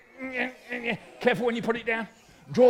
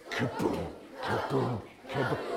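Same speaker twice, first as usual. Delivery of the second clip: low voiced thuds, one after another; imitated falling thuds; 10 semitones lower, 5 dB quieter than usual. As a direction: imitate bodies dropping with low booms. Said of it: "The weak ones".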